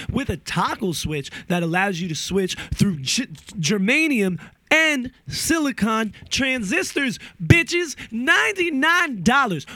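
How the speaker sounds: high, rising hype for the pedal; ninety-nine dollars, yelled high with glee; excited sales pitch. Real, not acted. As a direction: pitch it like a hyped infomercial.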